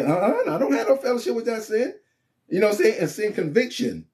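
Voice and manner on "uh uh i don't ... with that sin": mock refusal.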